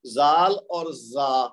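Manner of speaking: زال اور زا drawn out very slowly; contrasting letters. Shown as a demonstration. Teacher slowly contrasting the two letters for students. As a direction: say زال and زا slowly, each sound distinct. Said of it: "یہاں ہم گڑبڑ کرتے ہیں".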